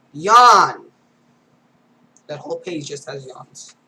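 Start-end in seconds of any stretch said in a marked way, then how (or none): 0.1-0.8 big loud stretched yawn, falling at the end; loud yawned word. Acted. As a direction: belt the word Yawn out like a huge yawn. Reading a page of yawns.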